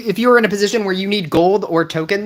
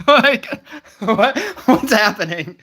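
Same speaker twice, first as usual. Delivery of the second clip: laughing confusion; 4 semitones higher, slower than usual. Real, not acted.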